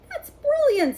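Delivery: high, loud delighted cry. A narrator's reading. Ron loving the plan to send Norbert, high and delighted. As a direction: burst out with joy.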